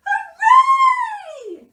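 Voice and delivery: very high, loud squeal; elated Mouse. A narrator's reading. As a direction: cheer shrilly as a tiny mouse. Mouse rejoices.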